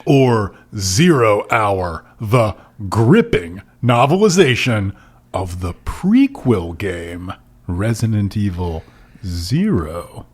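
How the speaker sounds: slow, drawn-out promo read, sinking at the end; milk each phrase with dramatic pauses; mock announcer. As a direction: trailer announcer winding down to the title. Reads out last title.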